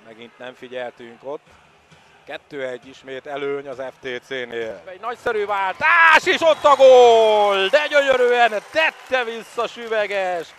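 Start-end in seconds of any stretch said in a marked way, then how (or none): 4.9-10.5 loud, high goal shout; excited goal call. Real, not acted. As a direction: roar the goal, thrilled. Home goal in water polo.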